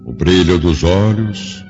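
normal talking voice

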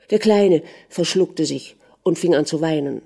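usual speaking voice

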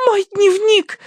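very high alarmed squeal; girlish cry. A narrator's reading. Daughter fears for her diary, crying out. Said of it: "вскричала Тосси".

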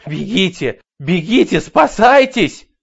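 rising to a high, slow scream; frantic shout. Acted. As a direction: warn everyone in panic. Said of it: "кричала она".

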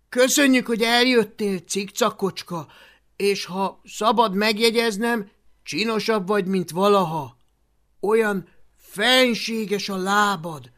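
high, loud gushing courtesy; butter up a proud royal; flattery. Acted. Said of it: "hízelgéssel talán célt érhet".

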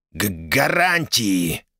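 slow, halting chitter through pain; biting down on pain. Acted. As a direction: stammer it out, holding back a scream. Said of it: "прострекотал магистр, сдерживая крик боли".

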